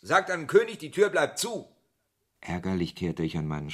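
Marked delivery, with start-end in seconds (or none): 0.0-1.7 louder, higher, final; defiant refusal. Acted. Narrator refusing the king's messenger through the door, loud and defiant. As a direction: slam the door shut with your voice.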